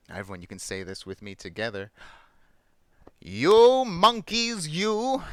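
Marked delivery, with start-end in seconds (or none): 3.2-5.2 drawn-out, high, loud scolding; acted telling-off, stretching each word; scolding peddler. Peddler shaking a finger at the monkeys, scolding. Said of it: "shaking a finger at them".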